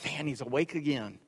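mock weary devil. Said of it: "the devil goes".

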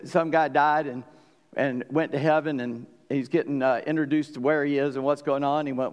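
usual speaking voice

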